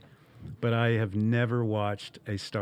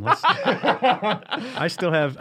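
laughing; 10 semitones higher, 5 dB louder, much slower than usual. Real, not acted. Laughing mid-line.

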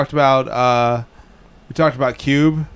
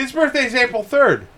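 surprised; 10 semitones higher than usual. Real, not acted. Surprised exclamation.

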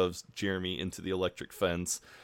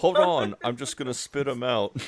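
raised, garbled mouthful voice; mock mouth full; 4 semitones higher, 5 dB louder than usual. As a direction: imitate someone talking past a mouth stuffed with olives. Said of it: "still got thirteen olives in her mouth".

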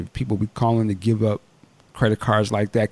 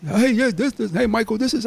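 high-pitched imitated African accent; 12 semitones higher than usual. Scammer introducing himself by phone, mock African accent. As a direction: mimic an African caller, pitched up. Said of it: "like, kinda African or something".

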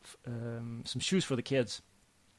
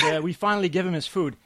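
normal talking voice

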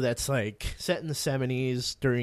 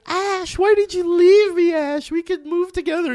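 high, loud, pleading mock wail; wailing girlfriend's skull; 7 dB louder than usual. Undead girlfriend pleads with Ash, high mock wailing.

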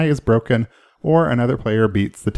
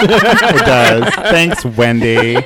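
laughing; 7 dB louder, much slower than usual. Real, not acted. Laughing after Wendy's noise.

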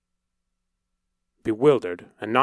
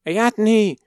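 alarmed cry; 11 semitones higher, 6 dB louder than usual. A narrator's reading. Mother knocked down.

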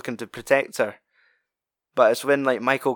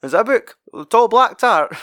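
mock retort; 9 semitones higher, 7 dB louder than usual. [name] ribbing the storyteller, mock teasing voice. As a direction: drag out the tease, higher and louder.

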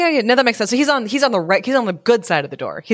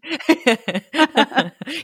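laughing; 6 semitones higher than usual. Real, not acted. Listener laughs at joke.

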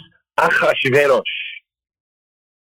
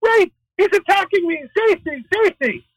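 very high-pitched hammed-up shriek for help; mock screaming. Esther staging an attack by Haman, retold as high mock screams. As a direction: cry out in a high, staged panic. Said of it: "starts screaming".

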